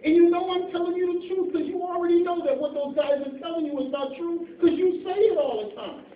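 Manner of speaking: loud, rising sermon voice; full-force preaching. Acted. Preacher pleading with the congregation, loud and driving. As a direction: preach it hard to the pews.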